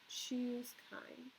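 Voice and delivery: whispered chapter title. Real, not acted. Teacher announces the next chapter in a whisper. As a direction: breathe the title out softly. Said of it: "announces chapter".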